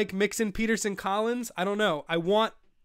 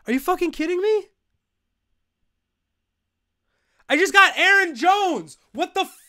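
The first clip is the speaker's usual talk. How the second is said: high, loud, fast raging yells; furious shouting; 11 semitones higher, 7 dB louder than usual. Real, not acted. Streamer rages at the auto-draft taking Aaron Jones, loud and high. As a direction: let the outrage explode at the screen.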